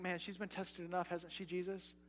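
a quiet, pleading protest to Jesus; softly asking Jesus if she hasn't been tested enough; soft hushed objection. Retold or imitated. Voicing listeners' quiet objection.

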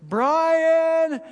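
high, loud urgent call. Retold or imitated. Wife calling husband under the car, loud urgent call. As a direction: call over the grinding noise so he hears.

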